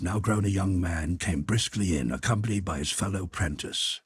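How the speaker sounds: ordinary delivery, nothing marked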